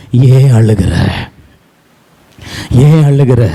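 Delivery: tender consoling husband. Acted. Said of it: "Elkanah சொல்றா".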